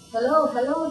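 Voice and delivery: calling hello. Acted. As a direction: call out to the silent line. Firemen answering the phone.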